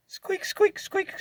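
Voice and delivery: high squeaky mimicked squeak; squeak imitation. Imitating his truck's squeak for viewers.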